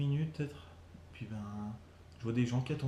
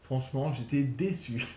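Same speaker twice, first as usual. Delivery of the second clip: ordinary talk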